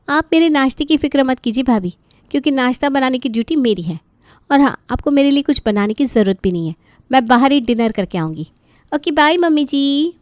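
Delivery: sassy, dismissive, tossing it off on her way out; young sister-in-law voice. Acted.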